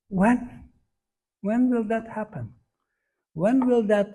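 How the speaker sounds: each "When" pitched high and leaned on; stress on When. Shown as a demonstration. Teacher showing the emphasis of the prayer, high stress on "When". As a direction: repeat "When" as a high, insistent, longing question. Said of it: "So the emphasis in this prayer is".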